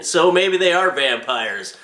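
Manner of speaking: usual speaking voice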